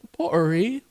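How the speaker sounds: "pottery" with a glottal stop; British dropped T. Shown as a demonstration. Showing how they talk, "pottery" without its T. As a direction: swallow the T in "pottery". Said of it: "they don't say their T's".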